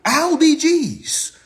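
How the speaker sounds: exasperated drawn-out substitute swear; drawn-out exclamation. Real, not acted. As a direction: stretch it out like a stand-in curse. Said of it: "I can't curse too much".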